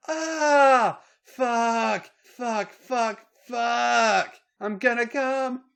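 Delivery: high, loud repeated cries; acted high-pitched screaming. A narrator's reading. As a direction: scream each word out separately. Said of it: "Charlotte screamed".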